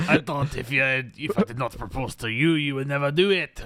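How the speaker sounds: mock French accent. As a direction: play the girlfriend in a put-on French accent. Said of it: "I'm trying to do a French accent".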